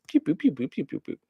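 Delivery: high pew pew laser sounds fading out; scanner noise. Retold or imitated. Waymo scans the rider, mock laser sound. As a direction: mimic a scanning beam.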